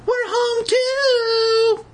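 very high, slow, loud call; high sing-song call. Acted. Mother calling out, sung high.

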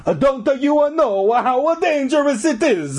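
very high, hammed-up Italian warning; bad Italian accent. A narrator's reading. Janitor warns the kids, exaggerated Italian accent. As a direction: belt the warning in cartoon Italian.

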